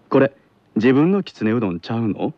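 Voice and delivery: Kansai model line for repeating; Kansai dialect. Shown as a demonstration. Teacher giving pupil a line to repeat, Kansai dialect. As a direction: say it evenly with a Kansai lilt. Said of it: "続いてください".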